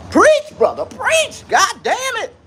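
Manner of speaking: very high, loud mock amen-corner shout; preacher's cheering congregation. Livestreamer egging himself on as a congregation, high mock shout. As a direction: cry out like an excited churchgoer.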